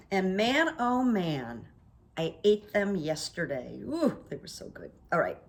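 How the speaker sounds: normal talking voice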